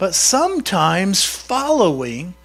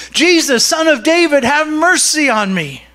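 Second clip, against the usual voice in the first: raised, pleading shout; shouting plea; 6 semitones higher than usual. Acted. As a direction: call out urgently over a crowd. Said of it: "he began to shout".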